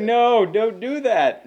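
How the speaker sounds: high, loud, slow pleading; theater crowd reacting to the bellow, mock plea; mock audience plea. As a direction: beg him to stop, hammed-up. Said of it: "it's like".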